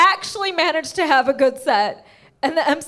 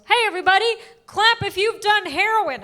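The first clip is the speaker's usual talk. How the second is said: higher-pitched crowd-work call; first open-mic comic; 7 semitones higher than usual. Retold or imitated. First comic addressing the audience, lightly mimicked hype. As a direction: play a comic hailing the room.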